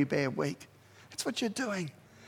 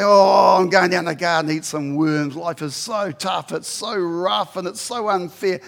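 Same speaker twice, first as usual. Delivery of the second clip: mock pouting; 6 dB louder than usual. Shown as a demonstration. Preacher demonstrates what pouting means, self-pitying. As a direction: whine mockingly, feeling sorry for yourself. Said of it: "Pout means to say".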